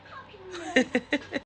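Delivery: high-pitched laugh; amused laugh. Real, not acted. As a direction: laugh in a high voice at your own story. Laughs at mama's imagined look.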